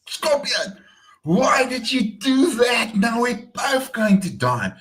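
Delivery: high-pitched anguished cry; drawn-out, acted wail of protest; outraged frog. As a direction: cry out at the one who betrayed you. Stung frog confronts scorpion in story.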